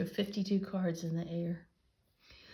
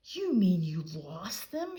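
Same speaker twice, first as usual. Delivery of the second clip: shouted; 5 dB louder, slower than usual. A narrator's reading. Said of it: "shouted D W".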